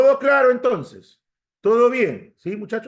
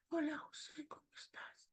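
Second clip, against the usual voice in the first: barely audible, whispery imitation; tiny hushed voice, much softer than usual; 7 semitones higher, 23 dB quieter than usual. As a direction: imitate someone you can hardly hear. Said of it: "Hay gente que no se le oye".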